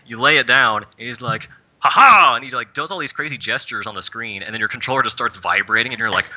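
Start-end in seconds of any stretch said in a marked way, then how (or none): 1.8-2.3 loud, high hammed-up villain cackle; villain laugh. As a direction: gloat with a big mock laugh. Villain triumphs before the trick.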